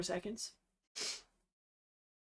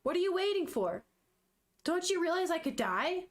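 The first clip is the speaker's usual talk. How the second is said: higher, urgent demanding mother; impatient dying mother; 7 semitones higher than usual. Acted. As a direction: push him to hurry, a bit shrill in pitch only. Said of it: "she goes".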